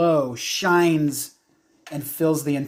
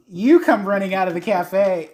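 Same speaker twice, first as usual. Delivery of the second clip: ordinary talk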